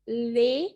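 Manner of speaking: ले said firmly as lay; demo word. Shown as a demonstration. Teacher repeating demo words for practice, firm. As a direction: model ले with a clear ay. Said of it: "आप लोग ज़ोर डालें".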